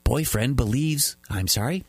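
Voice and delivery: usual speaking voice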